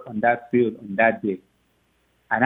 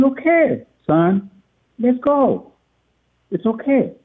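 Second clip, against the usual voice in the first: higher, bright eager okays to his son; eager reassurance; 9 semitones higher, slower than usual. Retold or imitated. Father reassuring his crying son, eager and higher. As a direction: cheer him up and get moving.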